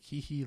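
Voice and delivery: high mock anime-girl giggle; dainty mock hee hee. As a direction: giggle like a cartoon schoolgirl. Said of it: "I'm actually an anime character".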